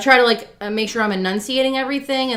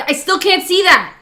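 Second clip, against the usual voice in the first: high, loud retold protest; exasperated retort; 11 semitones higher, 7 dB louder than usual. Acted quarrel over seeing something.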